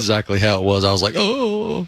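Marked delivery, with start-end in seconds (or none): 1.2-1.9 high drawn-out "ohhh" of pain; mock wailing. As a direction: wail it out, long and pitched up. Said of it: "you just hear the wailing".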